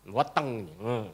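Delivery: usual speaking voice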